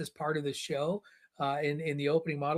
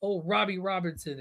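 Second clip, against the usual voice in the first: ordinary talk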